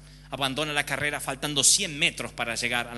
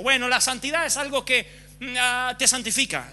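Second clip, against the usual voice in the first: high, louder smug churchy tone; mock pious cliché; 8 semitones higher, 4 dB louder than usual. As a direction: parrot a hollow pious phrase. Mocking pious cliché quote. (tono beato de burla)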